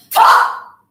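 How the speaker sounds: explosive one-word shout; screamed command. A narrator's reading. Bully orders silent girl to speak, explosive shout. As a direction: burst out at full force.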